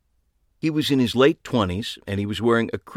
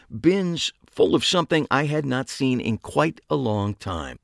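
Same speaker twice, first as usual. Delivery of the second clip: plain reading voice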